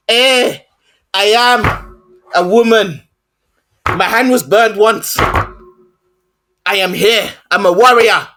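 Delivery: halting, declaimed imitation of an African accent; mock African accent. Mocking the actress's accent in the film, exaggerated African accent. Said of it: "Let me do the accent that she did".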